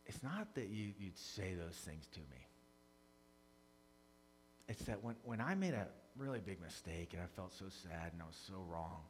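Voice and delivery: soft, low, halting retelling of a wounded wife; hurt reply. Retold or imitated. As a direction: quietly explain the hurt, with long pauses. Wife's hurt reply, retold softly.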